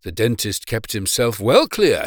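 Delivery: plain reading voice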